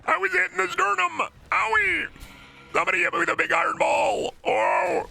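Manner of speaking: high falsetto YouTuber yelps; squeaky parody of a hurt YouTuber; high-pitched mock pain; Markiplier impression. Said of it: "Markiplier, but he was hit".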